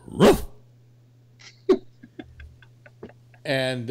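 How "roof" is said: short barked "roof"; dog bark. Acted. Talking dog answers the riddle in a joke, barking. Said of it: "And the dog goes".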